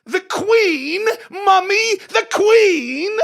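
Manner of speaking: very high, very loud British cry; British royal shriek. Acted. Daughter answering the Queen Mother, shrieked British retort. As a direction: shriek each word in a British accent. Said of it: "It sounds better in a British accent".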